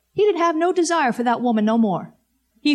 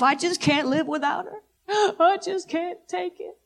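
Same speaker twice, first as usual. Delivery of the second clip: higher, softer hammed-up whimper; mock crying, pitched up, with a laugh; mock whining; 6 semitones higher, 4 dB quieter than usual. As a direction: imitate a lovesick young man whining. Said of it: "he's just crying and whining".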